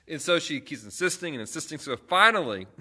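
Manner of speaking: usual speaking voice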